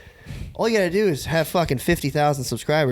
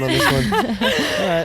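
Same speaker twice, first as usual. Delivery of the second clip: laughing; 3 semitones higher, much slower than usual. Real, not acted. Laughing sign-off.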